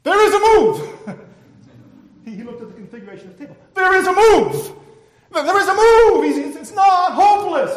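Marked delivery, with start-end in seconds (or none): 0.0-1.2 high, drawn-out triumphant shout; excited shout. Acted. Chess player at the painting finds a way out, shouting. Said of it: "And then he shouted". 3.7-7.8 high, loud, insistent cries; excited shouting. Acted. Chess player insisting the young man has hope, shouting. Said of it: "And then he shouted".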